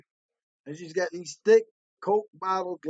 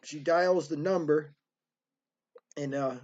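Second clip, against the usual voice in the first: usual speaking voice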